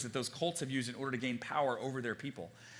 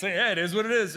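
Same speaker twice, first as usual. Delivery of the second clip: louder, brushing-off it is what it is; mock breezy dismissal; 5 semitones higher, 8 dB louder than usual. Mocking the cliche to the congregation, dismissive. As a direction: toss it off breezily. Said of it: "That's what it means".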